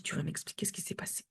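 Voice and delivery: very soft, near-whispered request; retold calming murmur; hushed reassurance. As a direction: coax an explanation out quietly.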